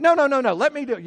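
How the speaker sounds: put-on high, loud kid's "no, no, no"; a playful imitation of a stubborn toddler; mock little girl. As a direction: insist like a frustrated small child who won't be helped. Daughter refusing help with shoes.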